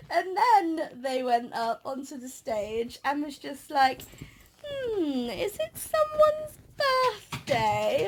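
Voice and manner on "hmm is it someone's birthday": high, slow, teasing dame voice; hammed-up panto dame. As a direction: drag it out like a panto dame fishing. Ugly Sisters voiced again.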